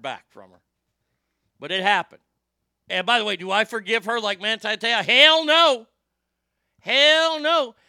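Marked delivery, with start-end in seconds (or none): 5.0-7.8 high, loud, drawn-out refusal; emphatic shouted refusal. Real, not acted. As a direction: belt it out as an absolute refusal. Emphatic refusal to forgive her.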